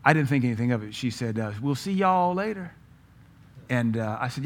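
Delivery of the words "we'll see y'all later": higher-pitched attempt at a Southern "y'all"; imitated Southern accent. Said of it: "trying to do a Southern accent".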